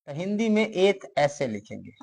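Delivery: usual speaking voice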